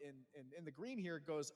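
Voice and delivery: usual speaking voice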